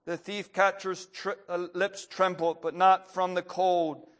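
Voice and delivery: earnest read-aloud storytelling, building in the tense parts; story reading. A narrator's reading. Preacher reading a story to the congregation, earnest. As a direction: tell the martyr story to the congregation.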